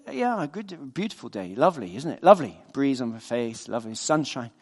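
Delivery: low, soft laid-back fisherman; contented fisherman. Retold or imitated. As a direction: lightly voice a man at ease, quietly. Relaxed fisherman in a told story.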